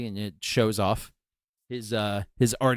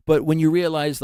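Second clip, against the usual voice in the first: ordinary talk, nothing marked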